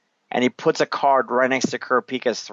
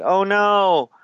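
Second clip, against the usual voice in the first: higher-pitched retold cry of warning; mock alarm; 7 semitones higher, 4 dB louder, much slower than usual. Puppeteer warns of falling barrels, mock alarm. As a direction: imitate a panicked warning.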